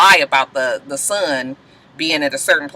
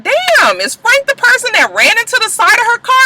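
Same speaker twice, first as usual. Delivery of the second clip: high, loud disbelief; shocked; 4 dB louder than usual. Real, not acted. Fan realizing who crashed the car, high and shocked. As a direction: gasp it out in shock. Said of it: "I was just like".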